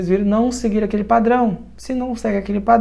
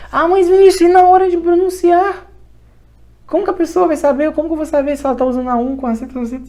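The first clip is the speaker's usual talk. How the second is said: high, loud protest speeding into a rush; mock a worried student's objection; raised-pitch imitation of a fretting pupil; objecting student; 6 semitones higher, 4 dB louder, faster than usual. Voices a student's objection to him.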